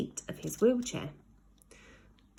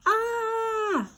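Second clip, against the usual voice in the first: high held falling scream; a long high scream as he falls. A narrator's reading. Ned screaming as he tumbles back to earth, a high held scream. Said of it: "screamed the boy".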